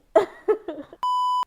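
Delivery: loud high-pitched giggle; high laugh. Real, not acted. Teasing about her future man, loud giggle. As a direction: burst into a squealing laugh.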